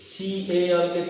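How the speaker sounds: ordinary talk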